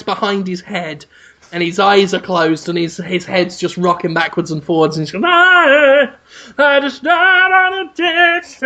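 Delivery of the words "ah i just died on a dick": high sung-out moan, slow and drawn; mock dying moan. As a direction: croon it out like an ecstatic last breath. Acting the sweaty man's dying moan.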